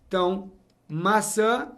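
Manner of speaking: ordinary talk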